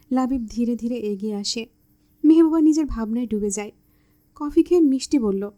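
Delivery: ordinary delivery, nothing marked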